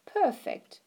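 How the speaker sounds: grandma cooing over the cake. Acted. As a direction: coo it with delight. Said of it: "Grandma cooed".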